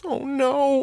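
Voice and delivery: put-on high, dismayed girl voice; mortified girl. A narrator's reading. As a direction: groan it in dismay. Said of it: "Ellie muttered".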